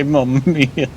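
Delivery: lightly mocked Italian "Mamma mia", falling on "mia"; a hint of a stage Italian, "mia" dropping. Streamer jokes about the Italian port-town kid, light Italian accent. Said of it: "Surprised he didn't say".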